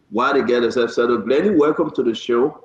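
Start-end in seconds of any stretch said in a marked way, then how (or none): none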